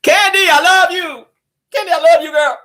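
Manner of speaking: stretched, high-pitched shout of affection; calling out affection. Pretend. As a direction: holler love across to her. Calling out love to Candy.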